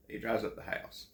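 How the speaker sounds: ordinary delivery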